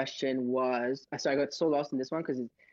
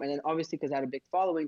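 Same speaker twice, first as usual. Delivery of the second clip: ordinary talk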